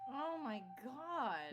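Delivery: drawn-out slow stunned oh my God; shocked. Real, not acted. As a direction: gasp it out in disbelief. Shocked at game scene.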